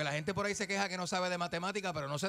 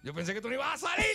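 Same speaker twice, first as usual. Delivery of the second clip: higher, quicker surprised reply; own surprised reply; 9 semitones higher than usual. Retold or imitated. Retelling his own reply to the ex-con, high and fast. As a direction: play your own startled answer, fast. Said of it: "yo decía".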